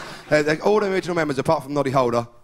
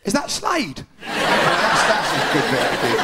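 confused drummer; slower than usual. Retold or imitated. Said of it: "suddenly he stops and he goes".

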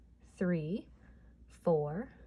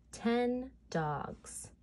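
ordinary delivery

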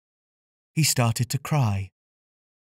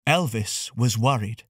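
ordinary delivery

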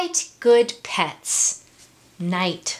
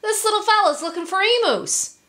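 high, fast kangaroo call; calling back; 11 semitones higher, much faster than usual. A narrator's reading. Kangaroo explaining to kookaburra overhead, quick high call. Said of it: "the kangaroo called back".

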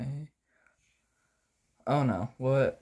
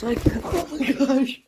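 high, loud laughter through words; laughing helplessly mid-sentence; 10 semitones higher, 5 dB louder than usual. Real, not acted. Laughing while being attacked.

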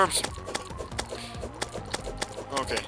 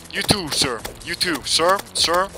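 prim telling-off, slightly raised and deliberate; mock-polite scolding; 4 semitones higher than usual. Host to intruders destroying the lawn, mock-polite scolding. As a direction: playfully lecture a rude guest.

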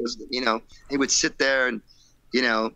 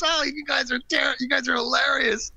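higher, amused voice laughing through the words; laughing producer. Retold or imitated. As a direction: chuckle it out, tickled by the band. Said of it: "He's like, started laughing".

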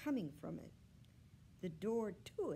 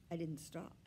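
ordinary delivery, nothing marked

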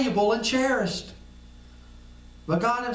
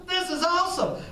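high, delighted exclamation; thrilled at the gift; Jesus overjoyed at his gift; excited Jesus; 9 semitones higher than usual. Acted. Jesus voiced reacting with joy.

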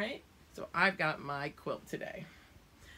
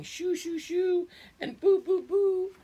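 high loud scaring calls; scary monster shooing away children; 10 dB louder than usual. Acted. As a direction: shoo them away like a monster. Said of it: "growling and showing my sharp teeth".

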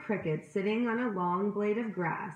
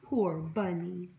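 ordinary delivery

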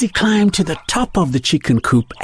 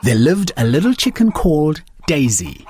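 ordinary delivery

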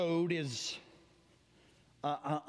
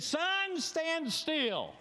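high commanding call; crying out in faith; 10 semitones higher, much slower than usual. Retold or imitated. Joshua commanding the sun in faith, high call. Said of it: "Joshua cries out in faith".

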